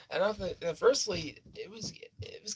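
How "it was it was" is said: hushed whisper; whispering the scary part. Real, not acted. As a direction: drop to a whisper as it gets tense. Recounting tense encounter.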